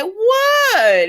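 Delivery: high, loud fake-surprised what; a high, loud feigned-innocence yelp; mock innocent shriek. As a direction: play it wide-eyed and shrill-high. [name]'s mock-innocent reply.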